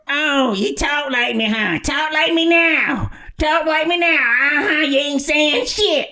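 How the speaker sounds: high slow taunting grandmother; grandma voice. Retold or imitated. Grandma teases her grandson at the door, high slow taunting voice. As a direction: tease the kid slowly in a high old-lady voice. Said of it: "do her voice".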